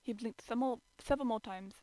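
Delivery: ordinary delivery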